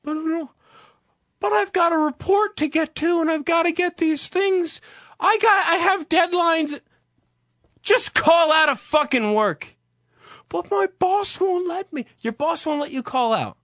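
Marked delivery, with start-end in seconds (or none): 0.0-6.8 high-pitched, pleading excuse-maker; mock whiny worker. Voices an objecting office worker. 7.8-9.8 high, slow, emphatic outburst; exasperated retort. Real, not acted. Snaps back at the imagined worker. 10.5-12.0 high-pitched, pleading excuse-maker; mock whiny worker. Voices the worker objecting again.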